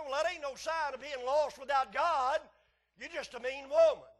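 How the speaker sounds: loud, high-pitched retold scolding; blunt rebuke. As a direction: set her straight at full pulpit volume. Retells his blunt rebuke to woman.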